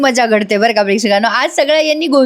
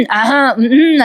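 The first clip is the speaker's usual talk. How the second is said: pointed mock cough and hum; teasing throat-clearing; much slower than usual. Friend teasing a caught-out suitor, knowing ahem. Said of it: "असं करायला लागतं ते सगळे यशाला".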